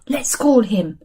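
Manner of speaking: normal talking voice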